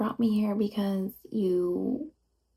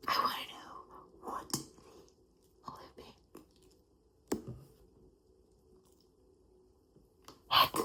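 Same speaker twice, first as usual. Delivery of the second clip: frustrated whisper; 11 dB quieter, slower than usual. Acted. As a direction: start almost silent, then burst out on heck. Frustrated demand for answers.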